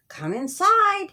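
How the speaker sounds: lightly voiced friendly dad inviting them in; friend's dad welcoming. Friend's dad opens the door to the visitors, lightly voiced welcome.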